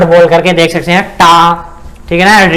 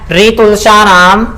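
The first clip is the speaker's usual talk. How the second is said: slow sutra recitation; much slower than usual. Shown as a demonstration. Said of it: "recites the sutra slowly".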